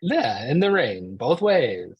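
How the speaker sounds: loud, drawn-out mock hardship tale; exaggerated back-in-my-day bragging; mock old-timer boasting.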